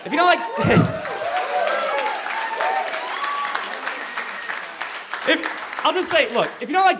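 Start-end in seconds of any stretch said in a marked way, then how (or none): 0.4-5.2 high shriek. Real, not acted. Loud high shout.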